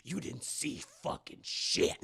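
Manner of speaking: creepy low threat. Retold or imitated. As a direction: lean in and threaten her quietly. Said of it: "That's right, Esther to Max".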